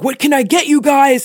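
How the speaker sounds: a lightly voiced waiter, tongue above the teeth; waiter impression. Imitating a restaurant waiter taking their order. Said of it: "a waiter who held his tongue in a really interesting way".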